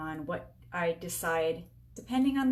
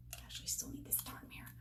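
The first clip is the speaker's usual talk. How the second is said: whispered grumble; 16 dB quieter than usual. Real, not acted. Muttering while applying lipstick.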